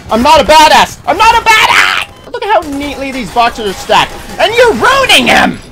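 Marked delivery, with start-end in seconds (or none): 0.1-2.3 high, loud yell of panic; panicked shout. Real, not acted. Panicking under attack in game. 4.4-5.6 high, loud indignant cry; outraged yell. Real, not acted. Scolding enemy wrecking stacked boxes.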